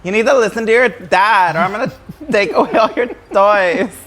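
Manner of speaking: lightly voiced Valley Girl scolding; a hint of a bubbly Valley Girl threat; Valley Girl Krampus. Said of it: "Just a Valley Girl version of the Krampus".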